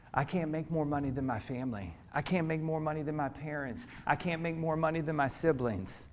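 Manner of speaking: hushed, voicing old self-doubt; quiet inner voice. Preacher quoting his own doubting thoughts, hushed. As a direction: murmur the head trash softly.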